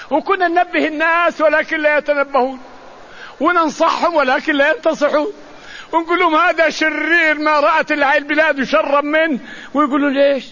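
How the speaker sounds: fervent sermon climax; heated preaching. Real, not acted. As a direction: press the warning home. Preacher recounting warnings, heated.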